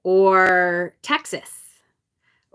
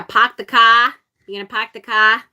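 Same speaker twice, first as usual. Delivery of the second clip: Boston accent dropping the R; 5 dB louder than usual. Shown as a demonstration. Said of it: "Boston no R thing going on".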